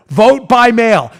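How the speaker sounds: slow, loud sign-reading; facetious reading. Acted. Host reading a county website button, slow loud mocking readout. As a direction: spell it out for the clueless.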